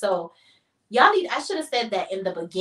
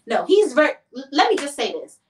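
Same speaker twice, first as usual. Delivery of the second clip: ordinary talk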